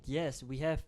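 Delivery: ordinary talk, nothing marked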